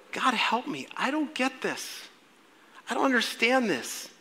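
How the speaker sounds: soft, high acted prayer; quiet, helpless mock bafflement; bewildered plea. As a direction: plead upward in hushed confusion.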